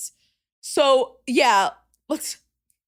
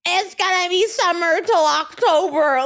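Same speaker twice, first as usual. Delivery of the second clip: mocking Laura; 4 semitones higher than usual. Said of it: "quoting Laura".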